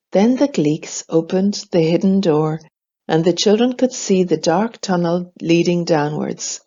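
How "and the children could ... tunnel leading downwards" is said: plain reading voice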